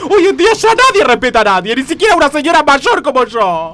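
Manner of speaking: high, loud indignant complaint; worked-up grievance, pitched high. Acted. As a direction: complain in outrage about lost respect. Indignant complaint after street quarrel.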